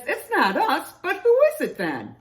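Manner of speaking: high, loud puzzled question; exclaiming bear. A narrator's reading. Bear wonders who is down there, exclaiming.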